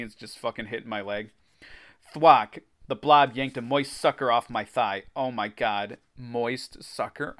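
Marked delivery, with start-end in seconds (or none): 6.2-7.3 grossed out. Real, not acted. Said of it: "I'm gonna fucking retch".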